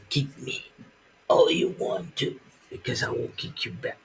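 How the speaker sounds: soft menacing whisper, fast at end; whispered mock threat. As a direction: hiss the threat softly, then rush.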